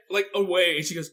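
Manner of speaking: ordinary talk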